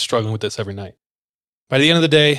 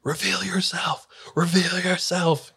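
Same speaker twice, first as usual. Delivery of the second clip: high, hushed imitation of screaming; whispered mock scream; 7 semitones higher, 5 dB quieter, slower than usual. Pastors screaming at the presence, imitated in a strained whisper. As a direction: scream it under your breath, drawn out. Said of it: "as they scream".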